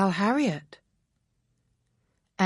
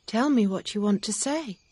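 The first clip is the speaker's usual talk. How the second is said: put-on higher young woman's voice, inviting; Emma, gentle; 6 semitones higher than usual. A narrator's reading. Emma encouraging Mr Knightley to speak, higher voice. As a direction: coax him to go on.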